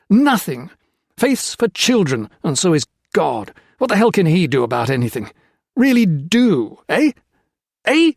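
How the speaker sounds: loud, high, bitter outburst; angry tirade against faith; furious rant. A narrator's reading. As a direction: tear into the priest with contempt. Peter's furious rant at the priest.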